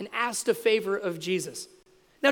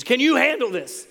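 punchy tough-guy challenge, pitched up; put-on tough-guy dare, higher than usual; Chuck Norris; 4 semitones higher, 4 dB louder than usual. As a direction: challenge them like an action hero. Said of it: "that's the Chuck Norris version of this".

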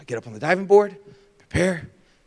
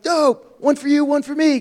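put-on higher, gleeful kid brother; excited kid; 8 semitones higher than usual. Older brother gloating over the floats. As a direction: claim the floats with glee. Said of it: "I'm like".

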